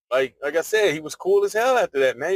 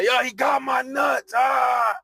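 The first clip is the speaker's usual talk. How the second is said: higher, slower mock yell of pain; retold scream; 9 semitones higher, much slower than usual. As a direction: cry out as if he's grabbed you. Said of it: "And I'm screaming out".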